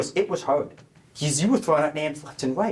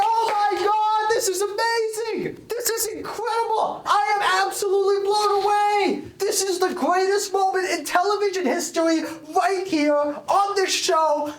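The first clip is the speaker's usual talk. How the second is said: excited shriek. Acted. Host freaks out over choice.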